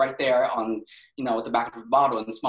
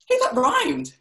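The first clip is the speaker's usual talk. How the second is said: high, louder delighted exclamation; pleased surprise; 6 dB louder than usual. Acted. Patient noticing an accidental rhyme, pleasantly surprised. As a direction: pipe up, tickled by your own words.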